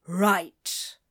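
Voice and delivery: low, clipped-down flat agreement; tight-lipped reply; suppressed irritation. A narrator's reading. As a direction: hide your annoyance. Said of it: "keep the irritation out of his voice".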